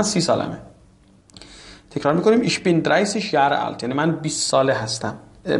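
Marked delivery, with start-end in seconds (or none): none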